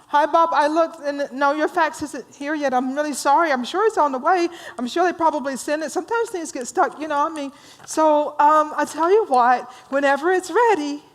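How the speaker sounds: high, eager-to-please apology; kind romantic. Acted. Joe apologizes to Bob about the fax, kindly and eager. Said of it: "'Cause he's kind like that".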